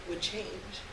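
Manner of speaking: usual speaking voice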